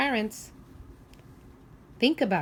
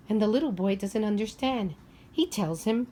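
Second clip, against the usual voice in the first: usual speaking voice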